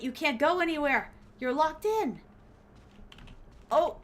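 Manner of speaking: ordinary delivery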